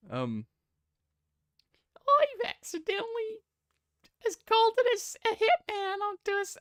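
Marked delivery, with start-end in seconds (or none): 2.0-6.6 very high cartoon-kid falsetto; badly faked, squeaky little boy; Ralph from The Simpsons. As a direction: strain up into a childlike falsetto. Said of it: "do an impression of Ralph from The Simpsons".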